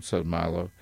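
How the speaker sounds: usual speaking voice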